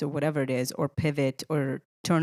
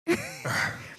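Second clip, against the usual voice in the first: high squeaky burst of laughter; a shocked little laugh that shoots up high; surprised laugh. Real, not acted. Hearing the producer's unexpected answer, a high startled laugh. Stunned laugh at his reply.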